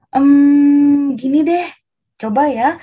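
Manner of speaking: long loud thinking hum, then a proposal; hesitant bargaining. Acted. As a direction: stall with a drawn-out hum. Gideão pensa num teste.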